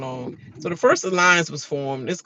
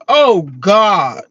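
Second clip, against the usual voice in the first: louder, exasperated groan; imitating husband; 4 semitones higher, 7 dB louder, much slower than usual. Imitating her husband catching her crying, exasperated. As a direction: sigh it out, fed up. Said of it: "my husband was like".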